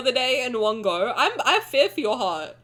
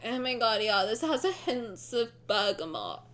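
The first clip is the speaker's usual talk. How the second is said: mock wine snob; 4 semitones lower, 9 dB quieter than usual. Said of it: "their little glasses being like".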